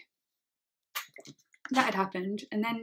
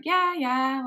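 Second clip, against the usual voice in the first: breezy mock-casual yeah, higher and louder; airy, imitated nonchalance; nonchalant; 5 semitones higher, 6 dB louder than usual. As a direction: imitate a friend brushing it off as no big deal. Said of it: "like, nonchalant".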